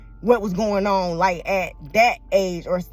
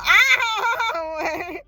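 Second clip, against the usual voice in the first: high teary laugh; real laugh with a catch of crying; teary burst of laughter; laugh through tears; 10 semitones higher, 3 dB louder than usual. Real, not acted. Laughs while tearing up.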